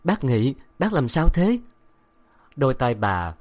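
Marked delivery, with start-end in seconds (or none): 0.0-1.6 worried. Acted. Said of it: "lo lắng".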